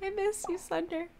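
high, soft little plea; small voice full of longing; quiet pining. Acted. Player answers absent Sunder, small and wistful.

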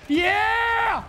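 excited. Real, not acted. Clutch win in match.

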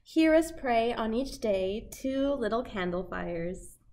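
ordinary delivery

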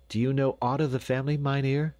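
high, rushed, eager question. A narrator's reading. Dame questioning the doctor, high and eager. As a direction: ask it fast and high, rising. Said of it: "said the dame eagerly".

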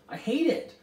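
high, loud outburst; passionate cry. A narrator's reading. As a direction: cry out with fierce feeling. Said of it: "Charles Wallace cried passionately".

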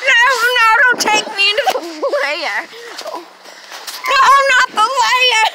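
high, loud mock panic; panicked pleading. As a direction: beg not to be taken. Pleads as captured victim.

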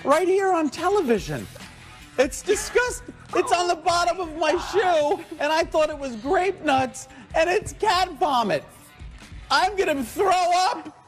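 disgusted. Real, not acted. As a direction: recoil in disgust. Realizes he ate cat vomit.

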